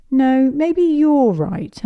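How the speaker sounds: put-on high, slow little-girl voice; Susie voice. Susie to Pepper, high sweet little-girl voice. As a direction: sing it up high and drawn out.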